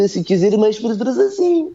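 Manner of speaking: exaggerated Portuguese melody on a high pitch; Portuguese intonation. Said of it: "they have a specific intonation pattern".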